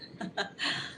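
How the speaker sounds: a short, breathy real laugh. Real, not acted. Laughing during the live chat with viewers. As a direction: break into a quick laugh. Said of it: "मुझे हंसी आ रही है".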